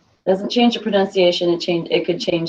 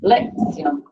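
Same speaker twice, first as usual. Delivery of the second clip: "Lección" misstressed high on the first syllable, LEK-syon; stress on first syllable; 7 semitones higher than usual. Shown as a demonstration. Teacher showing the word without its accent, first-syllable stress on "Lección". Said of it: "if I don't write that accent in there".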